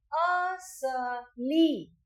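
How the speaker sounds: असली drawn out in three syllables, a-sa-li; slowly stretched demo. Shown as a demonstration. Teacher leads children in repeating असली, very slow. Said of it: "तो मेरे साथ बोलिए".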